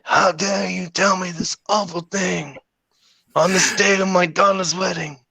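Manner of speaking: high, mock mafia don voice; hammed-up Godfather, stuffed cheeks; Godfather impression. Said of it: "I'll be the Godfather".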